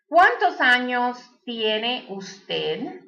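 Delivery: slow, careful Spanish with a rising end; slow teacher's model question in Spanish. Shown as a demonstration. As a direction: say it slowly so learners can copy. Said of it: "That means, how old are you?".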